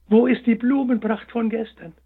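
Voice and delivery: bewildered hare. A narrator's reading. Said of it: "schaute sich verwundert um".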